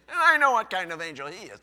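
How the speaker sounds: loud, fast playful jab; teasing wife. Retold or imitated. Pastor voicing his wife teasing him, loud and fast.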